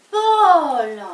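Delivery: sharp loud cry of his name; indignant. Acted. Wife protesting to husband Paul, indignant shout. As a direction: snap his name in outrage.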